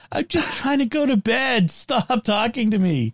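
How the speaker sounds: high-pitched fed-up complaint, slowing at the end; exasperated pleading. Acted. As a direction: plead with the gadget, dragging out the last words. Acted complaint to chatty assistant.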